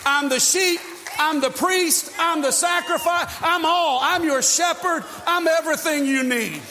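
loud and high, rising with each claim; building proclamation. Real, not acted. Preacher voicing Jesus to the crowd, building loud proclamation. Climactic list.

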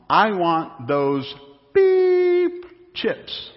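put-on a slow, pleading little girl's whine, then a high bleeped word; preacher voices a child begging for chips, squeaky beep; little girl demanding. Child begging mom for chips in grocery store, whiny.

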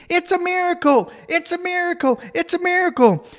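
high, excited kid chant, acted; little girls screaming. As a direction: imitate a gaggle of shrieking girls in a high pitch. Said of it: "all the little girls start screaming".

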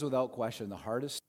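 normal talking voice

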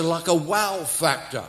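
ordinary talk, nothing marked

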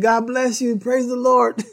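high, sing-song God bless you, with a touch of mischief; sweet blessing. Retold or imitated. Husband answers the cursing driver with high sweet blessing. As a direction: kill her rudeness with sugary kindness. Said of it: "I said".

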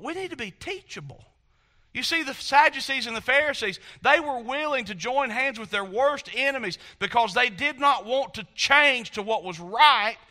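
ordinary delivery

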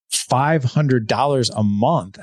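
slow, incredulous repeat of the price. Retold or imitated. Friend balks at mentor's monthly price, slow mock disbelief. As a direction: stretch out each word in retold disbelief.

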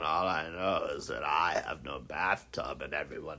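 hammed-up, low-pitched whinging; imitated complaining. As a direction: drop far down into a sickly complainer's voice. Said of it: "when she starts complaining about".

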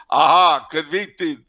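triumphant accuser in fake Amazonian accent; mock Amazonian accent. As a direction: pounce loudly on AHA in a made-up Amazonian accent. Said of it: "if that was an Amazonian accent".

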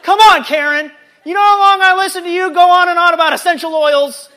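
loud, high comic outburst of frustration; mock exasperation. Worker fed up with Karen's blank stare, exasperated mock rant. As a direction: lose patience with a coworker, hammed up and shouted. Acted outburst at unresponsive coworker.

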